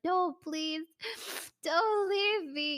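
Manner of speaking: pathetic pleading. Retold or imitated. Girlfriend begging boyfriend to stay, high slow mock plea. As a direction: beg him not to go, hammed-up. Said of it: "really pathetic stuff".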